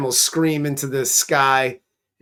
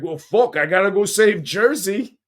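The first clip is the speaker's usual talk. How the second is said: put-on a light Jersey Italian accent, a bit higher and louder; Italian-American Superman; 5 semitones higher, 4 dB louder than usual. Superman as a Jersey Italian guy, mock Italian-American accent. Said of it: "Superman's Italian. He's like".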